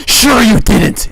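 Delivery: milk the repeat, hammed-up disbelief; exaggerated sarcasm.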